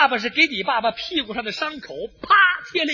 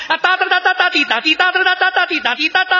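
high, rapid-tongued bugle call; imitated bugle charge; 5 semitones higher than usual. Our side sounds the charge, imitated bugle call. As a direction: trumpet the charge with your mouth. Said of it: "吹响了嘹亮的冲锋号".

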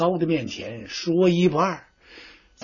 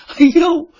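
high, loud pained cry; yelp of pain; 11 semitones higher, 4 dB louder than usual. Acted. Slapped envoy cries out in pain and disbelief, high and loud. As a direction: struck official wailing in shock.

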